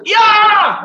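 sharp, high martial-arts yell; kiai shout. Shown as a demonstration. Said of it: "it goes".

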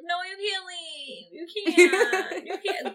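high, slow pleading through laughter; mock clingy whine. Imagining her vampire boyfriend leaving, high laughing whine. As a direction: beg him to stay, half laughing. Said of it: "I'd be like".

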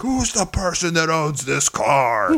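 flexing showman calling out the owner; mock wrestler. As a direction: wrestler flexing in the street, slow hammed-up challenge. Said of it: "flexing and he's like".

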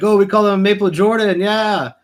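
hammed-up Canadian accent, drifting Jamaican; failed Canadian accent. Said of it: "that's Jamaican".

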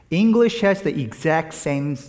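ordinary delivery

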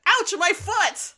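high, slow, loud mock yelp; Starscream yelping. Starscream hit in the thruster, a high slow yelp. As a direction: yelp it out like a hurt robot. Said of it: "Starscream goes".

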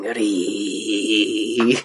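a low, thin "Ree" from inside the coffin; vampire squeak. Retold or imitated. Dio squeaking from inside the coffin, low creature noise. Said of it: "you hear Dio inside going".

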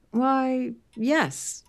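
perplexed. Acted. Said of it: "Merton looked perplexed".

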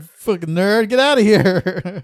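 loud, slow mock jeer; mock insult. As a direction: bark it like a jock bully. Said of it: "You're like".

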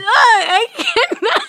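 high, drawn-out words through laughter; helpless laughter. Real, not acted. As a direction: give up, cracking up completely. Co-host overcome with laughter.

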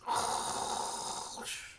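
breathy pretend snore; fake snore. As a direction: softly fake a snore as if dozing off. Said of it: "that's my best fake snore".

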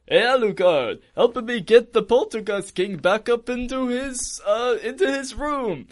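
high, singsong mock villain dad voice; Dracula. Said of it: "Dracula says".

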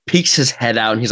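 ordinary talk

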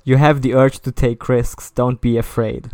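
loud, declaimed fortune reading; dramatic horoscope reading. Acted. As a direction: proclaim it like a recorded oracle. Reads the horoscope aloud.

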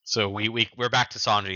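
ordinary talk, nothing marked

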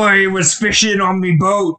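hammed-up pirate accent; mock pirate accent for a joke. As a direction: talk like an old sea pirate, playing along. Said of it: "we're just pirates now".

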